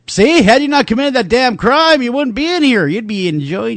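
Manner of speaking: high, sing-song lecture; mock scolding. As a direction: lecture an inmate with mock righteousness. Imagined lecture to an inmate.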